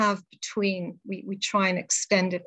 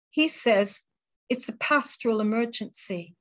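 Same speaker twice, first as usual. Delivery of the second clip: poem recital. Acted. Reads final poem.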